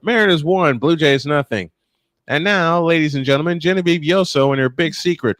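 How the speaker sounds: usual speaking voice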